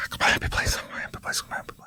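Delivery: hushed, voiceless pleading whisper; whispered self-soothing. Retold or imitated. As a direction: whisper it like a desperate little mantra. Self-soothing in the scanner, acted.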